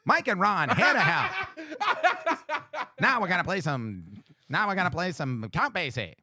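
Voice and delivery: put-on old-time radio announcer; cheery vintage radio host; 1930s radio voice. Shown as a demonstration. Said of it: "talk like a radio voice from the nineteen thirties".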